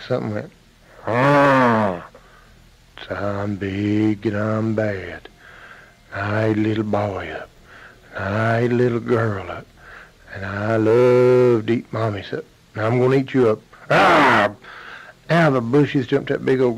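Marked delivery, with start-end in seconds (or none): 1.1-13.6 big bad bear. Bear in the bushes threatens to eat. 13.9-14.5 loud, sudden bear roar. Acted. Bear pounces on the mother.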